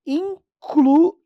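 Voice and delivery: teacher stretching out incluíram; slow demo word. Shown as a demonstration. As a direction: spell incluíram out by sound. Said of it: "Aí você tem lá, ó".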